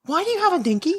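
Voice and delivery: put-on high kid voice, close to laughing; imitated puzzled child. Confused child questions mom, high imitated kid voice. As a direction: ask it in a high, plaintive child's voice. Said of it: "And I'm like".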